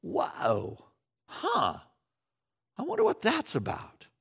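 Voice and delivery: pause, look up, puzzled; wondering surprise. Retold or imitated. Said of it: "stop and go".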